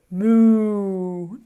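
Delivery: a drawn-out pretend cow moo. Teacher mooing for the children, a playful cow sound. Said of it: "then you have to say".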